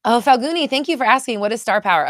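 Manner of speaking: high, loud and thrilled; bursting with delight at the question; real excited. Real, not acted. Thrilled host answering a viewer's question, high and loud. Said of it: "I'm so excited".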